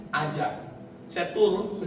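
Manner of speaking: ordinary talk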